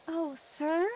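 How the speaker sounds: high, soft, timid little voice; soft baby voice. Retold or imitated. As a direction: call out sweet and meek. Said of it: "I'm using my soft baby voice".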